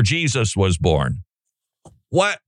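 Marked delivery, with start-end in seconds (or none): 2.1-2.4 high, incredulous what; pitched-up mock what; mock disbelief. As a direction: act shocked at what you just read.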